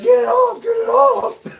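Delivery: very high squealing voice for the dog; panicked dog voice. Retold or imitated. Owner imitating his dog caught in wire, squealing high. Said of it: "He's like".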